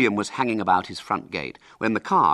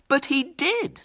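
very high, desperate protest; 4 dB louder, slower than usual. Acted. William insisting to Mrs Lane, desperately. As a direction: squeak out a desperate objection. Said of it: "said William desperately".

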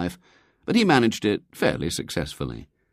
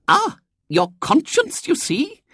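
very high, louder, slow lady's voice; triumphant; 4 dB louder, slower than usual. A narrator's reading. Visitor sees father falter, triumphant. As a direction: crow over being proved right. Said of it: "said the visitor triumphantly".

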